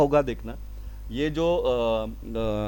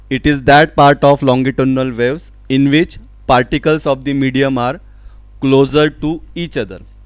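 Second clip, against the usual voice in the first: loud, slow, measured reading; emphatic textbook read-out; definition dictation; 7 dB louder, much slower than usual. Real, not acted. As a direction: dictate it to the class, phrase by phrase. Reads out a definition.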